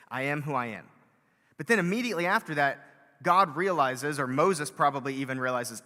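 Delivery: loud, rising sermon voice; loud, fervent preaching; preaching at full force. Acted. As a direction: preach it out to the congregation.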